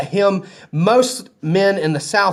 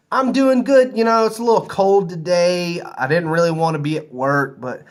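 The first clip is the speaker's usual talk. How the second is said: imitated chatty replier. Hotel worker answering a passing greeting in full, imitated chattiness. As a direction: mimic an over-sharing stranger's small talk. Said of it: "they stop me and they're like".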